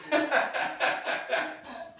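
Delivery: high airy laugh. Real, not acted. Laughing at the Golden Girls joke, high and airy. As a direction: laugh freely.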